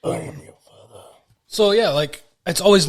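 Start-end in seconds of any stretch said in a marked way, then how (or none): 0.0-1.0 whispered menacing villain line; Darth Vader. Retold or imitated. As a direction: hiss it out as the masked villain. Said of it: "Darth Vader only says".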